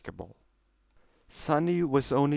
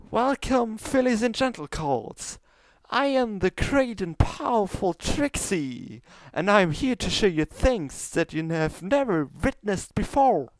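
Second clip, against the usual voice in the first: much higher, louder boastful stage voice; grand showmare announcement; 11 semitones higher, 6 dB louder than usual. Acted. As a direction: proclaim like a vain stage magician. Trixie opens her show.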